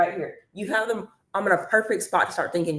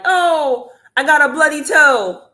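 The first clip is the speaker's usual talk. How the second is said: higher, louder hammed-up complaint; a hammed-up higher, louder whine about a bloody toe; mock poster voice; 8 semitones higher, 9 dB louder, slower than usual. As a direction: play someone posting about their injury. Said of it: "make content on here like".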